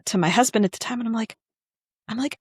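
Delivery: usual speaking voice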